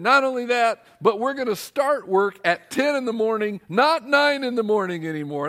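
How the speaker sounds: milk each new perk; mock excited announcement. Rep piling on perks, excited and loud.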